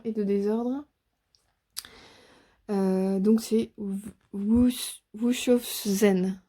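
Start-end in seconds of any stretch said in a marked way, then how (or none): 5.1-6.4 "Wushofzen" sounded out very slowly, syllable by syllable, as "oo-shof-zen"; careful foreign name. Real, not acted. Reader unsure how to say the card's name, slow careful try. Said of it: "Pardonnez-moi mon accent".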